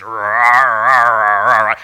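loud growling rahs like a boiling cartoon; cartoon rage growl. [name] fuming like an old comic, loud rah growls. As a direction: bark out angry rahs, blood boiling. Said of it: "the blood would come right up here".

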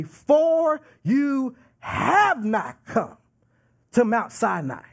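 slow, drawn-out FOR YOU HAVE, weight on every word; emphatic. Acted. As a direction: preach "have not come" slowly and forcefully. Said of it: "He is saying that emphatically".

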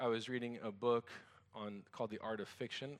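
usual speaking voice

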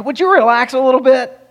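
high-pitched annoyed onlooker; exasperated, put-on plea to settle down; mock exasperation. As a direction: complain like someone in a disrupted crowd. Annoyed crowd under torn roof.

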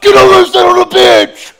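very high, very loud furious yell; angry shout. Retold or imitated. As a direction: scream it at the kid from the sideline. Benching son in anger.

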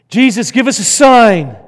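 loud, high, insistent demand; demanding crowd. Acted. Crowd loudly pressing Jesus for a sign, demanding. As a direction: bark the demand up at him.

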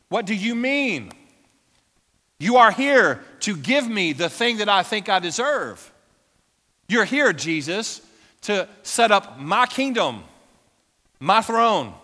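an entitled listener protesting loudly to Jesus; indignant demand. Retold or imitated. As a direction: demand the crown you think you deserve. Crowd demanding a kingdom.

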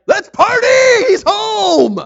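father welcoming his son home, overjoyed shout; joyful shout. Retold or imitated. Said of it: "his dad's like".

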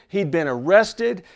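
usual speaking voice

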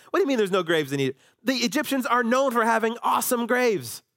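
mock-sarcastic scoff. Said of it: "See, they're being sarcastic".

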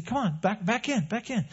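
high, soft shooing call; gentle shooing. Pretend. Shepherd shooing sheep back in, soft high call. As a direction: shoo them in gently. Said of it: "you kinda shoo 'em".

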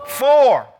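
loud, high warning call; hollering fore. Retold or imitated. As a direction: yell it across the course. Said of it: "have to holler".

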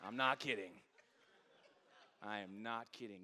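usual speaking voice

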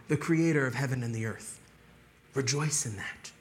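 normal talking voice

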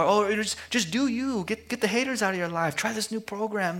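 lightly hammed-up life-coach voice; mock self-help guru.

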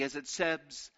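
ordinary talk

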